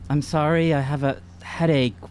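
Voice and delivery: usual speaking voice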